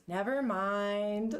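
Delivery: very slow, drawn-out, low dismissal; evasive brush-off. Acted. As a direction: stretch it out to drop the subject. Acted evasive brush-off in story.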